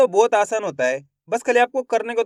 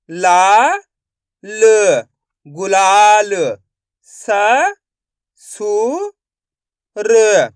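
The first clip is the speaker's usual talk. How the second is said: loud separate syllables, then blend the word; syllable by syllable phonics; 6 dB louder, much slower than usual. Shown as a demonstration. Teacher sounds out syllables loudly for the class. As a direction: sound out each syllable for young learners.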